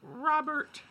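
squeaky mock caller; high-pitched caller voice. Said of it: "someone calls in".

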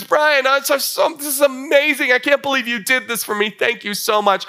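high-pitched acted delight, easing down toward the thanks; overjoyed wife. Wife thanking husband for her birthday present, acted overjoyed. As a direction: gush with happy amazement as an imitated wife. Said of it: "she's so happy".

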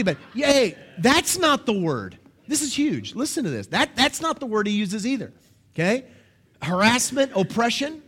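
usual speaking voice

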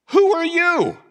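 who punched high and loud, then falling; a rebuke flung at anyone talking back to God; sharp rebuking question. Acted. Preacher reading Paul's rebuke to his listeners with a sharp high cry.

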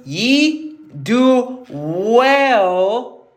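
"ye", "do", "well" each stretched very slowly; drawn-out emphasis. Real, not acted. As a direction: hold "well" long so it sinks in. Preacher dwells on key verse.

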